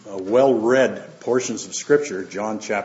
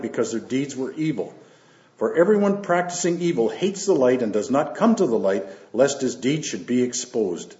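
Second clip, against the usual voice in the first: raised, measured Bible reading voice; scripture reading; 3 semitones higher, 3 dB louder than usual. Acted. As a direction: read the Gospel out to the church with weight. Reads scripture aloud to congregation.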